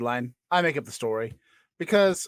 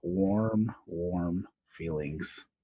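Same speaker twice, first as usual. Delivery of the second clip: slow, soft, lower sentimental drawl; 8 semitones lower, 5 dB quieter, much slower than usual. A narrator's reading. Narrator lingering over the warm moment, slow and soft. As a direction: stretch out warm, savouring it.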